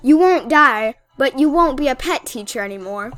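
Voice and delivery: loud, mock-indignant rant at the trainer; mock outrage. Outraged rant at rude trainer NPC.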